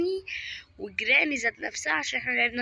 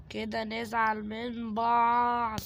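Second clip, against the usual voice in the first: slow drawn-out threat; much slower than usual. Acted. Taunting the game's Granny.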